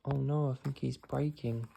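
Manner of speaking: ordinary delivery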